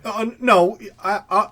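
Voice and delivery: backpedal, stumbling over the words; stammering. Acted. Cowed mercenary backs down after being shouted at, stammering. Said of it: "and he's, like, stammering".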